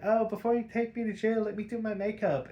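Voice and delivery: a hint of a coy cat burglar; mock Catwoman. Catwoman voiced in a retold scene.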